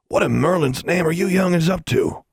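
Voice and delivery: lightly voiced stern caretaker; a hint of a gruff groundskeeper; stern older man. A narrator's reading. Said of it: "a deep voice".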